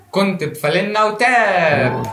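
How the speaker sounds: drawn-out, slow title call; show title announcement. Acted. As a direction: stretch the final word like an announcer.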